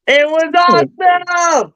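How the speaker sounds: high, drawn-out cheer; slow, high-pitched yell of delight; excited shout. Real, not acted. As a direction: shout it with glee, stretching the words. Raving about a movie seen twice.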